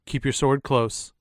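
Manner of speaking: ordinary talk, nothing marked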